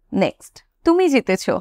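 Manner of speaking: normal talking voice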